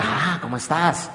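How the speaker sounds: flirty husband. Retold or imitated.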